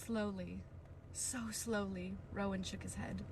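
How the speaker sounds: hushed. A narrator's reading. Quiet dramatic reading.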